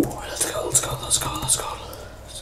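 hushed, voiceless urging; sneaky whisper. Real, not acted. Gamer sneaking up on players, whispering. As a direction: whisper it like you're creeping up.

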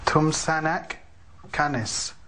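Tumsanak Canis, stress on Tum; native thanks demo. Shown as a demonstration. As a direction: say Tumsanak Canis slowly and clearly. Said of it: "how do you say it".

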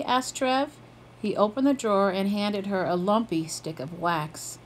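ordinary delivery

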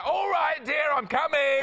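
put-on Bradford accent. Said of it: "my impression of people who worked in coal mines".

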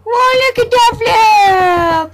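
high, slow, amazed shout at the screen; excited shout. Real, not acted. Gamer shouting in amazement at the flip, excited. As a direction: yell out in delight at a flip.

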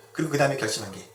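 ordinary talk, nothing marked

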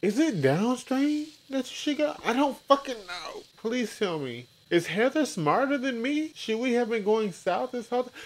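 sarcastic high-voiced questions; mock confused rant. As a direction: play the dumb bewildered one. Mock voicing Mike's confusion.

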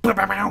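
mouthed rapid-fire blast noise; imitated attack noise. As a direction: act out the enemy's attack as a noise. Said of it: "that guy came around and, like".

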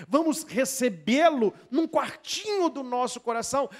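usual speaking voice

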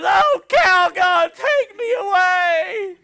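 high, loud, slow wail of despair; overwhelmed mom in the Calgon commercial crying out; frazzled mom. Retold or imitated. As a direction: wail it like a mother at her wits' end. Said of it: "she grabs her hair and she goes".

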